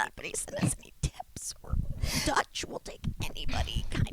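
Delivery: hushed aside, voice dropped; whispering. Real, not acted. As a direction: whisper it to the audience. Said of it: "we gotta do a timeout".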